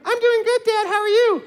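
put-on very high, sweet girl's voice; imitating daughter. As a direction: chirp like his adoring daughter. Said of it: "when I was her".

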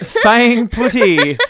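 loud, high, slow through laughter; laughing repeat. Real, not acted. Host delighted by co-host's spoonerism, laughing loudly. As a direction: crack up while repeating it.

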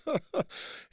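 real laughter breaking into the line; laughing. Real, not acted. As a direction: laugh it off and carry on. Laughing at his own joke.

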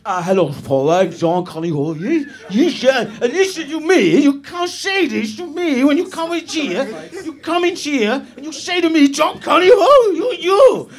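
slurred, lurching attempt at Dutch; bad Dutch accent. Contestant's audition piece to Paul, bad Dutch accent. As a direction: put on a garbled Dutch accent. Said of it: "it's Dutch".

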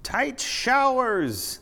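high, stretched-out exclamation; blurted in wonder. A narrator's reading. Tall clown hits his head in wonder, high drawn-out outburst. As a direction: call it out high and long, as a sudden discovery. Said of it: "the clown blurted as he hit his head in wonder".

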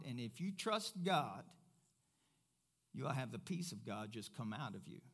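usual speaking voice

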